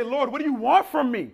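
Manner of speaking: loud, high-pitched plea to God; sarcastic exasperation. Acted. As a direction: throw the question up at God, fed up. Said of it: "get a bit sarcastic with God".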